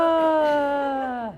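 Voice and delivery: long, loud open ah; demo release sigh. Shown as a demonstration. Teacher showing a calming breath in the car. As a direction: let it all out with a drawn ah. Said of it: "and just go".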